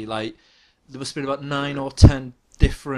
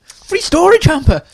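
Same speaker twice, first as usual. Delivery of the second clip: much higher, louder and slow; mock amazement; 11 dB louder than usual. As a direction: act stunned by the bargain. Mocking the infomercial offer.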